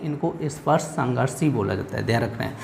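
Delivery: usual speaking voice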